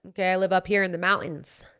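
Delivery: ordinary delivery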